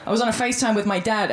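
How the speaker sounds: ordinary talk, nothing marked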